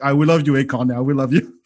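normal talking voice

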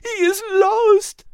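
high, slow and tearful; grieving character near sobs; tearful Liz. Acted. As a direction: let the voice break on lost. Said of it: "and began to sob".

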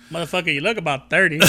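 lightly voiced tough cop interrogating; Bad Boys Two cop. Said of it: "I'm exactly ready to do the scene from".

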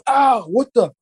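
high-pitched retold yelp of shock; pained outcry. Patient reacting to a needle through the sternum, pained outcry. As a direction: jump up in pitch as if jabbed.

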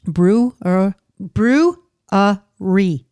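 BREWERY as "broo... uh... ree"; very slow, each syllable of brewery alone; three syllables. Shown as a demonstration. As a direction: sound out brewery in three separate beats. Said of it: "there's three syllables".

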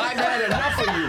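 loud, high teasing through laughter; playfully fed up, laughing. Real, not acted. Mock-dramatic sign-off banter.

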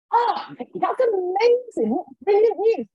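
very high, gushing delight; overjoyed vicar. Retold or imitated. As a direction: imitate a vicar thrilled by the news. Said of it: "so grateful and so happy for me".

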